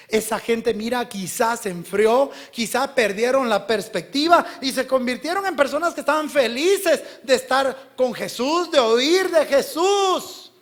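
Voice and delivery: loud, high, emphatic sermon delivery; full-force preaching. Real, not acted. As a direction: drive it home to the congregation. (prédica a toda voz)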